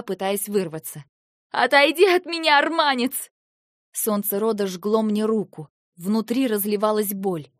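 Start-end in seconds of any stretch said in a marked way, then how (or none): 1.5-3.3 pained cry. Acted. Eva pushing Lentar away.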